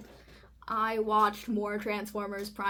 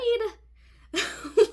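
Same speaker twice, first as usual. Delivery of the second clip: loud, high incredulous laugh; burst of laughter; 11 semitones higher, 11 dB louder than usual. Real, not acted. Reacting to own story.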